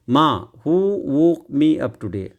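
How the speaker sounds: reads each word out very slowly; very slow read-aloud. Shown as a demonstration. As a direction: dictate it word by word. Reading Neha's line slowly.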